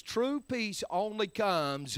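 ordinary talk

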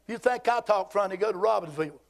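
normal talking voice

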